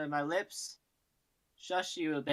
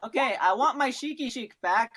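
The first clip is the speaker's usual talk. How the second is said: loud demand; 6 semitones higher, 6 dB louder than usual. Real, not acted. Player loudly demands move back.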